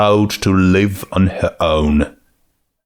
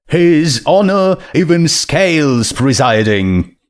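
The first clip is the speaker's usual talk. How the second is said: bailiff announcing; 6 semitones higher than usual. Acted.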